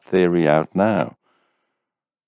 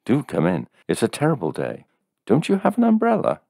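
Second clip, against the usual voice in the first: higher, fussing woman; lightly voiced landlady; 8 semitones higher, faster than usual. A narrator's reading. Landlady seeing the soaked visitor, mildly fussing. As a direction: fuss over him a little.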